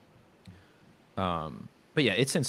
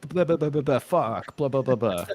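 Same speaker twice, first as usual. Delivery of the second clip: higher, louder mock teenage babble; chattering teenagers; 6 semitones higher, 5 dB louder than usual. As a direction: imitate kids gabbling in a foreign language. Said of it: "They'll be like".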